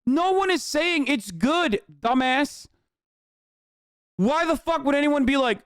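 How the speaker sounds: high, slow, scornful retort; exasperated, talking down to an idiot; exasperated snap. Real, not acted. Answering a chat question, high exasperated scorn. Snapping at a commenter.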